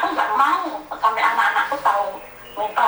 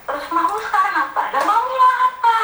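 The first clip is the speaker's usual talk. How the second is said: rising to a high, louder challenge; retold shout at the husband, higher and louder; retold angry demand; 11 semitones higher, 4 dB louder than usual. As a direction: throw the question back at him, getting angrier. Retold argument with her husband.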